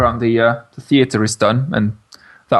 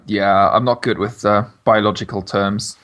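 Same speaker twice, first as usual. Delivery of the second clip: ordinary talk, nothing marked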